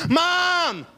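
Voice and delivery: high, far-reaching call for mom; child calling out. Retold or imitated. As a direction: shout across the house like a little girl. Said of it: "She's like".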